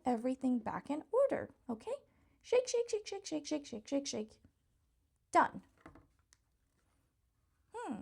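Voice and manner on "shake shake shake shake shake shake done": ordinary talk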